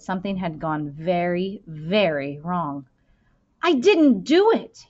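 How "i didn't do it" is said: loud, high protest of innocence; insisting. Acted. Boy insisting to the headmaster, loud and high. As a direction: denies it hard, voice jumping up. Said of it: "he insisted".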